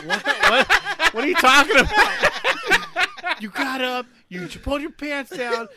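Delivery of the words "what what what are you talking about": high, loud, incredulous questions; mock-startled denial; retold bafflement. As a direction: protest in total confusion, amused. Retold baffled reply to accusation.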